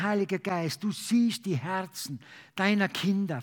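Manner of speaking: ordinary delivery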